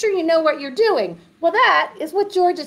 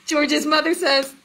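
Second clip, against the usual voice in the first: ordinary delivery, nothing marked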